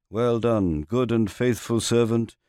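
usual speaking voice

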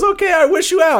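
high, eager mock uncle; dopey uncle. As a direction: chirp it like a gullible fool. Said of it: "And he goes".

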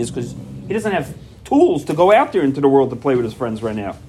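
ordinary delivery

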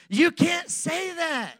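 high, slow shout at the ladies; playful shout. Real, not acted. Pastor playfully shouting at the ladies in the congregation. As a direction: call it out big and teasing.

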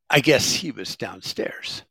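ordinary talk, nothing marked

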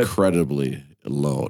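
deep low voice. Pretend. Voicing "lows are subterranean.

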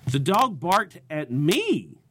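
slow line landing on a swooping "me"; stress on ME. Shown as a demonstration. Comedian keeping the joke lively, stress on "me". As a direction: save the big punch for "me" at the end. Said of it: "Pick a different word in your jokes to put inflection on".

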